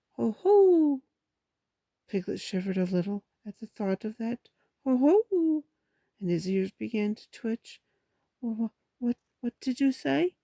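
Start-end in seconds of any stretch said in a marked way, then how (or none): 0.1-1.0 high, loud gloating hoot; gloating heffalump. Acted. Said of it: "gloating sort of way". 4.8-5.6 high, loud gloating hoot; gloating heffalump. Acted. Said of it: "gloating sort of way". 8.4-10.4 higher-pitched, stumbling question; nervous stammer. Acted. Pooh stammers, ears twitching in fright.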